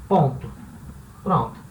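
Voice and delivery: ordinary talk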